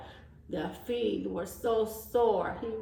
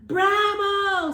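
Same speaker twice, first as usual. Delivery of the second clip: high, loud cheer; cheering bee; 8 semitones higher, 6 dB louder than usual. A narrator's reading. Bee cheering the goat, high and loud. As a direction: shout bravo like a proud fan. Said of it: "said the bee".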